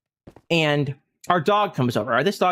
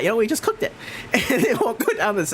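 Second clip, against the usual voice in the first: laughing through words; 5 semitones higher than usual. Real, not acted. Laughing while telling story.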